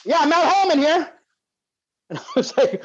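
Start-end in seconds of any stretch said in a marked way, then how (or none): 0.1-1.1 higher, offhand phone intro; mock oblivious caller; clueless caller. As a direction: announce yourself, unaware. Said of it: "all I hear is".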